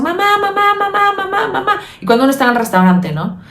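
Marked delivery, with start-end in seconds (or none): 0.0-1.7 put-on high, insistent little-kid whine calling mom; whiny child. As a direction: nag like a child tugging at mom. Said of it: "como los niños cuando dicen". (voz aguda de niño insistente)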